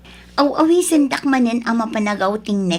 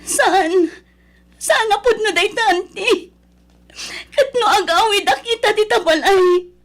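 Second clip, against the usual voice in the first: crying in disbelief; 7 semitones higher, slower than usual. Acted. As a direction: wail at the news, breaking into tears. Hears her father died.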